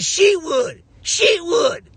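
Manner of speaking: high, loud Nigerian voice saying She would; mock the mispronunciation, high and loud; mimicking David. Imitating David on stage saying his name wrong, high and loud. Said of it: "He said".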